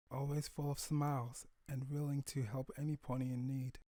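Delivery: soft, hushed prayer to his late wife; grieving father. Acted. Father praying to his dead wife, soft and hushed. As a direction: hold back tears and murmur it softly. Father tells daughter about mother.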